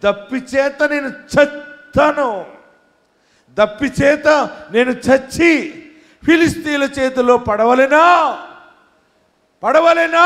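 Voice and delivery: high, loud, slow anguished plea to God; Samson's despairing shout to God; desperate cry. Acted. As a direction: cry out as though dying of thirst. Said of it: "సంసోన్ అన్నాడు".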